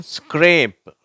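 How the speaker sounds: ஸ்க்ரேப் slow and high, s-k-r joined; SCR blend demo. Shown as a demonstration. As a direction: model SCRAPE for learners. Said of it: "வார்த்தைகளை பார்ப்போம்".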